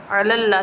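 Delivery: "अलल्ला" in three syllables, a-lal-la; correct reading. Shown as a demonstration. Teacher repeats the correct form, "अलल्ला" with every syllable sounded. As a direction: repeat "अलल्ला" with the short first vowel and doubled l. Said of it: "अल्ला नहीं पढ़ना".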